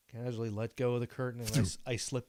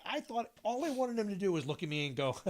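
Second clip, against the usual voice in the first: usual speaking voice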